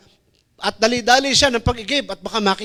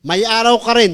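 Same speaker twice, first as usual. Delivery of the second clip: loud warning; 3 semitones higher, 6 dB louder than usual. Acted. Warning from pulpit.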